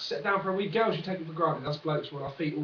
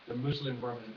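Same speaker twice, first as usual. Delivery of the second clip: ominous repeat; 3 semitones lower, 6 dB quieter than usual. Shown as a demonstration. Vlogger repeating the party name to viewers, lower and ominous. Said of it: "repeats the name darker".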